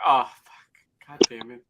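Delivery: normal talking voice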